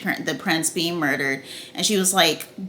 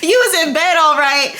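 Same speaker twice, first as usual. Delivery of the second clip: high, loud, cracking-up jeer; laughing mockery; 9 semitones higher, 6 dB louder than usual. Real, not acted. Host mocking Kristen's alibi, loud laughing jeer. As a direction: hoot the tease through laughter.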